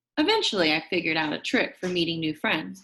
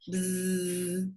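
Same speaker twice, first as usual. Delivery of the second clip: bee buzz; 3 semitones lower, 5 dB quieter than usual. Acted. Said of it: "finding my bees".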